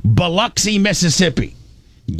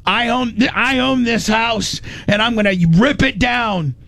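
mock angry husband; 8 semitones higher than usual. Husband threatening wife in retold fight.

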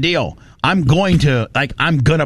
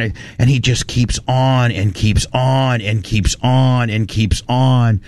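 ordinary talk, nothing marked

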